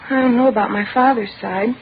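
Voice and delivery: ordinary delivery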